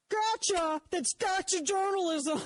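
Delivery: hammed-up indignant critic; mocking critics. Host mocks listeners crying gotcha journalism, high whiny put-on. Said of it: "they're like".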